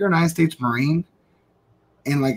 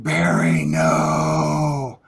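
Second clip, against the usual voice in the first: 1 warning no; 12 semitones lower, much slower than usual. Retold or imitated. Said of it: "I was like".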